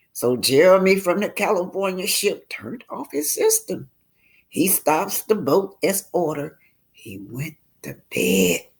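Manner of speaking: ordinary delivery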